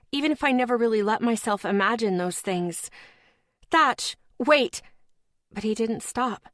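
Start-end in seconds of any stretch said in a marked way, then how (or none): 3.7-4.8 calling after him, raised. A narrator's reading. Narrator calls after leaving lover, raised. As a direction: shout his name as he walks off.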